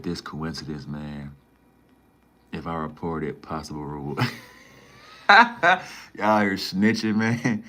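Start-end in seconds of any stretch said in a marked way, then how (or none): 5.3-7.6 laughing through the words, slow and loud; amused drawn-out laugh-talk. Real, not acted. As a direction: crack up while saying it. Laughing at chat calling him snitch.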